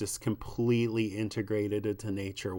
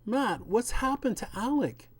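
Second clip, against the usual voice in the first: put-on raised, distressed young woman finding her friend overgrown; higher and louder, horrified at what she sees; Abby's alarmed voice; 9 semitones higher, 4 dB louder than usual. Wife cries out to husband about Alec, alarmed. Abby shocked at rooted Alec.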